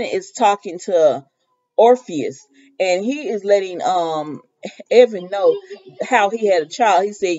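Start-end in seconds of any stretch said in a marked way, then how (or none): none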